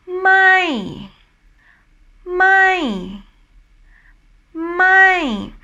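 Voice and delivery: "My" held high, then swooping down; falling tone. Shown as a demonstration. Teacher showing the voice go up then down, falling tone "My". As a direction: climb high on "My", then drop steeply. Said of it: "and then drops down".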